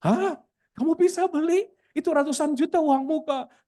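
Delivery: very high, amazed questioning; astonished disbelief. Retold or imitated. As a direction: act out being floored by the news. Pastor acts amazement at the purchase.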